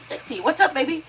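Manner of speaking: high, bright hello to a friend; sweet greeting. Real, not acted. Streamer greeting viewer Dixie, high and sweet. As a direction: greet her like a pet name.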